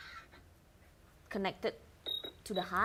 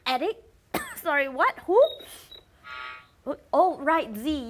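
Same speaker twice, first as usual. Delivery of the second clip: startled; 6 semitones higher, 8 dB louder than usual. Acted. Host startled, flustered.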